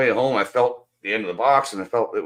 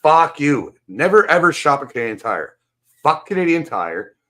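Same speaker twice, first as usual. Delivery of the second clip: much higher, much louder yelled curses; furious shout; 9 semitones higher, 8 dB louder, slower than usual. Pretend. Imagined raging at the Canadian Tire store, yelling. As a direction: scream it at the store staff. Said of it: "and say".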